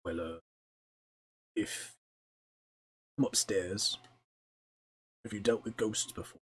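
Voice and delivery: halting words with long pauses; mildly nervous, reluctant confession; anxious, nervous. Acted. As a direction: hesitate uneasily before asking. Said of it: "clearly very anxious and nervous".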